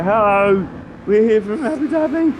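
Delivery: high, loud mock Scottish commentator; hammed-up Scottish commentator impression; bad Scottish accent. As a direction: ham up a terrible Scottish accent. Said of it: "that's the worst accent you'll ever hear".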